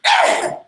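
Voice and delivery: short high yelp of pain; pained cry. Retold or imitated. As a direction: cry out as the blow lands. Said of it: "and Kunta goes".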